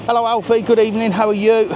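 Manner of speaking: high sing-song hello to a dog; cooing at a dog. Real, not acted. Greeting Alfie the German Shepherd, high cooing voice. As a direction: coo the greeting up high, like talking to a pet.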